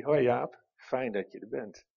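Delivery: soft, low, gentle greeting; tender fatherly welcome. Retold or imitated. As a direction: welcome him in quietly, like a papa. God as father greets him.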